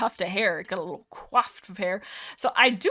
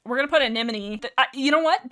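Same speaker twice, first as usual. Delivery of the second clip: ordinary talk, nothing marked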